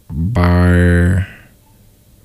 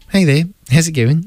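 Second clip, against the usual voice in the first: put-on higher New Zealand accent greeting; "Hey there" in a bright New Zealand accent; 8 semitones higher than usual. To his roommates, a playful New Zealand accent. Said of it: "in a New Zealand accent".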